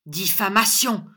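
loud, gloating accusation; crowing loudly; triumphant. A narrator's reading. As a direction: pounce on the word in victory. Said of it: "dit Squeers triomphant".

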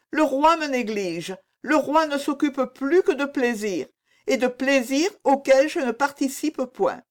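high, tearful-queen lament; plaintive complaint. A narrator's reading. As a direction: complain bitterly, pitched well up. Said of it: "elle se plaignait".

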